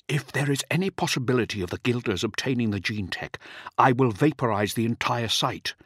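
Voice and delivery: usual speaking voice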